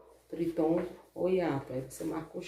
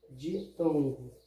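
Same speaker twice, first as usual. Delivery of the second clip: slow emphasis; 2 semitones lower, much slower than usual. Shown as a demonstration.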